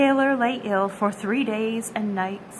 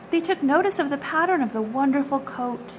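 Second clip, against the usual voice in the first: ordinary delivery, nothing marked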